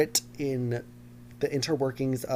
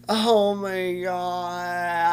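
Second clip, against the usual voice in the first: long drawn-out, higher, louder wail; overdramatic wail; 5 semitones higher, 5 dB louder, much slower than usual. Pretend. Host wailing over the TikTok ban, overdramatic. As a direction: stretch it out in mock anguish. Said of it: "I'm getting very emotional".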